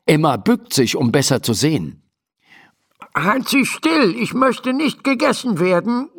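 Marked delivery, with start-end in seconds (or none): 3.2-6.1 very high, protesting gnome voice; alarmed little doctor. Acted. Little doctor inside the horse's mouth orders stillness, alarmed.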